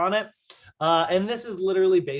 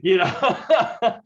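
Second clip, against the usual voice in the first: laughing; 6 semitones higher, 8 dB louder than usual. Real, not acted. Laughing after joke.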